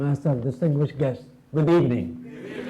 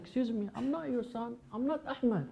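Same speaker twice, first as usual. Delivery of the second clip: much softer, high startled denial; startled protest; 5 semitones higher, 12 dB quieter than usual. Retold or imitated. Man denying to clinging woman, soft startled protest. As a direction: back away politely, hushed. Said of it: "I said".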